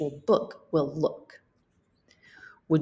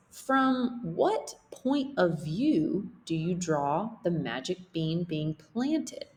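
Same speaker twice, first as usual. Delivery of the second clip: plain reading voice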